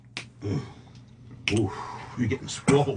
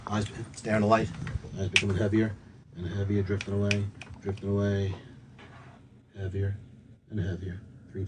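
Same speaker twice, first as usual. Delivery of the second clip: hypnotist voice; 6 dB quieter than usual. Acted. Hypnotist puts subject under again.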